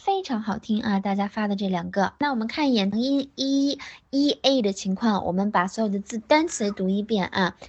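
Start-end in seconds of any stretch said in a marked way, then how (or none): none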